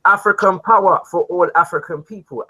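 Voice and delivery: called out high and loud; rally the crowd with it; rousing slogan. Real, not acted. Host shouts his opening slogan.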